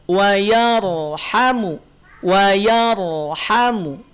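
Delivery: "yarhamu" slow, with the ro drawn out; slow, careful "wa yarhamu"; slow recitation. Shown as a demonstration. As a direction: stretch "wa yarhamu" to show the ro. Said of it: "Perhatikan di sini".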